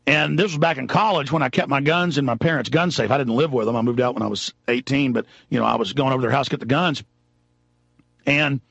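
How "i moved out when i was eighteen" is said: ordinary talk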